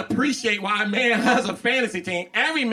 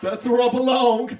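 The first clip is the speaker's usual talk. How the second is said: higher, offhand voice for the heart; heart as a casual dude; 5 semitones higher than usual. Retold or imitated. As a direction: toss it off, breezy and unbothered. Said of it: "My heart's like".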